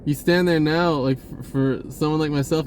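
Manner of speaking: ordinary talk, nothing marked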